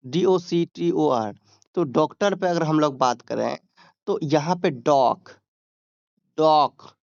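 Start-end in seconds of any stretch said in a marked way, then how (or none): 4.7-5.3 first syllable of doctor, डॉग, said drawn out; demonstrating the strong syllable डॉग; stress on डॉक. Shown as a demonstration. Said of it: "जोर देते हैं". 6.4-7.0 डॉग punched loud on its own; loud, isolated first syllable डॉग; stress on डॉक. Shown as a demonstration. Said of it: "जोर देते हैं".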